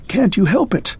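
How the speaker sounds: the repeat climbs up, begging; scared pleading question; pleading in fright. A narrator's reading. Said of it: "shivering from head to foot".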